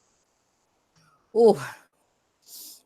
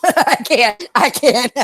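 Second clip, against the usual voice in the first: laughing; 4 semitones higher, much slower than usual. Real, not acted. Laughing, can't move fast.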